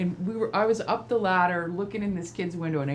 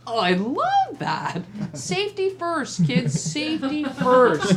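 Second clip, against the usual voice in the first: slow, dry mock approval with a laugh underneath; sarcastic praise; 5 semitones higher, 4 dB louder, slower than usual. Painter mocks kids in helmets, slow sarcastic approval. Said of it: "And I was like".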